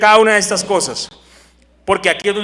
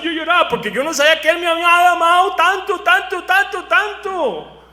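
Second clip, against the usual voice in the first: higher, moved testimony with a catch of laughter; emotional testimony; 7 semitones higher than usual. Acted. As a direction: tell how he cried, close to tears.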